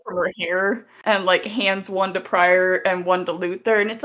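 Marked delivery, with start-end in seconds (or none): none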